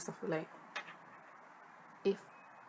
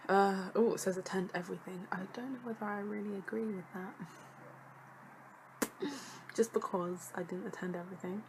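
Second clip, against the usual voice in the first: usual speaking voice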